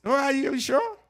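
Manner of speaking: a hint of a doubting caller; mock doubt. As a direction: sound openly unconvinced. Doubting caller in retold call.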